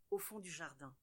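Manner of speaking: plain reading voice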